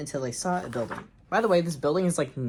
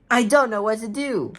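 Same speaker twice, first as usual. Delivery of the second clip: loud, high, hammed-up panic; panicked yell; 8 semitones higher, 7 dB louder than usual. A narrator's reading. John running the wrong way, yelling in panic. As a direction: cry it out as a helpless story character.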